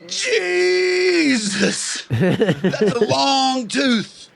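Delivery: high, slow amazed exclamation; astonished Boston guy. Retold or imitated. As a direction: drag out the shock like the guy in the chair. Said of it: "all I hear is".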